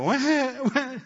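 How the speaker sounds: put-on a high, whiny baby wah; mock whining wah. Preacher mocking the whining voice, high whiny wah. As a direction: whimper wah like a crybaby. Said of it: "the voice of whining and wah".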